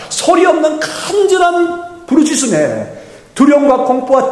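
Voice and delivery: impassioned sermon climax; full-force preaching. Real, not acted. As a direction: build the promise up to a ringing peak.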